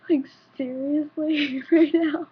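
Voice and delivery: laughing through the words; real laughter breaking through. Real, not acted. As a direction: giggle out the disbelief. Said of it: "laughs while speaking".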